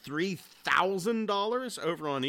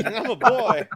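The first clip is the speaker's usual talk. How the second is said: slow, laughing repeat; 6 semitones higher, 9 dB louder than usual. Real, not acted. Laughing as he echoes the joke line back. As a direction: giggle through the words.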